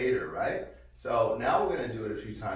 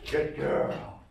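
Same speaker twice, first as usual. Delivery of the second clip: ordinary talk, nothing marked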